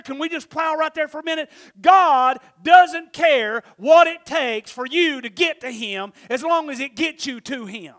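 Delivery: full-force sermon delivery; fiery preaching. Real, not acted. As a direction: drive the point home from the pulpit.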